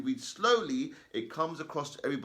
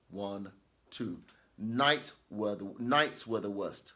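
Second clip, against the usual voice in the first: teacher modelling the gap between sentences; reading with pauses between sentences; 4 semitones lower than usual. Shown as a demonstration. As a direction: pause two beats at every full stop. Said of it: "I'll do that again".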